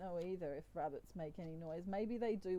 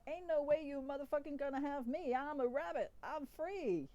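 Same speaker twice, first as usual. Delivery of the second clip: higher, defiant animal character; bad Donkey voice; 6 semitones higher than usual. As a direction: protest in a badly faked cartoon voice. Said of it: "That was the worst donkey voice ever".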